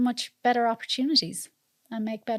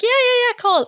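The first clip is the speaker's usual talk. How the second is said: high, loud, gushing mock welcome; insincere invitation; 13 semitones higher, 7 dB louder, faster than usual. Irish host inviting without meaning it, mock-insincere welcome. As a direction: overdo the empty invite. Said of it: "we don't really mean it".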